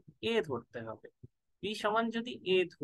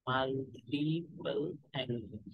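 multiple angle very slow and softer, falling; slow soft dictation; 5 semitones lower, 5 dB quieter, much slower than usual. Shown as a demonstration. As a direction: repeat multiple angle slowly and softly to close it. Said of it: "repeated softer for note-taking".

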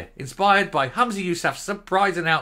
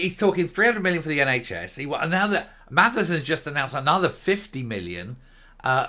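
ordinary talk, nothing marked

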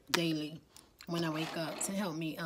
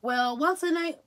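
high, loud mock question; pitched-up, exaggerated imitation of a viewer; mimicked commenter; 8 semitones higher, 7 dB louder than usual. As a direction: imitate a clueless commenter asking. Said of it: "some of y'all gonna be writing the comments".

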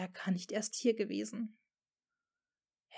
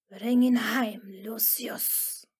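a hint of a cold command fading into a whisper; lightly voiced icy dismissal; the Dark Lord sending Lucius home in a cold, fading voice; 4 dB louder, slower than usual. A narrator's reading. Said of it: "klirrte die verhasste Stimme leise".

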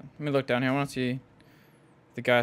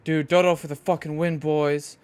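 excited; 3 semitones higher, 6 dB louder than usual. Real, not acted. Dodo raid clears all turrets.

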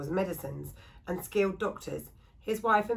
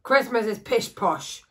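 slow, louder grumpy dismissal; gruff woodcarver; 3 semitones higher, 7 dB louder, slower than usual. Acted. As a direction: scoff at Christmas like a sour old hermit. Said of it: "said Jonathan gruffly".

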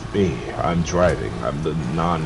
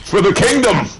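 high, loud charge cry; rally for the kingdom; hammed-up warrior cry; mock battle cry; 13 semitones higher, 4 dB louder than usual.

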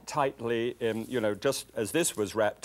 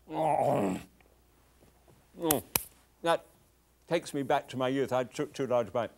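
talking through a mouthful of pie; mouth full. Real, not acted. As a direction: speak round a big bite. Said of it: "Forgive me talking with my mouth full".

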